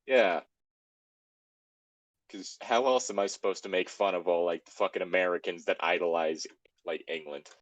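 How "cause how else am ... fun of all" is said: ordinary talk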